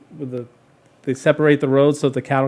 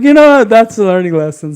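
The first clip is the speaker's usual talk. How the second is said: louder, higher, sharp no-nonsense mom; lecturing mom; 6 semitones higher, 9 dB louder than usual. Retold or imitated. Mother tells her son it's a lesson, loud and high. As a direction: drive the lesson home.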